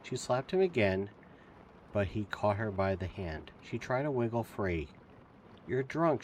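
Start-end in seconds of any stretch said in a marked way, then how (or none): 5.7-6.2 louder and higher accusing yell. A narrator's reading. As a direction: shout it at the drunk sheriff. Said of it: "she yelled".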